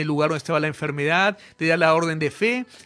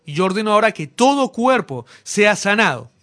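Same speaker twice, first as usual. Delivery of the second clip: preaching at full force; 4 semitones higher, 7 dB louder than usual. Acted. Preacher commanding healing over the whole congregation, loud and fervent. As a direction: thunder the order of faith over the crowd.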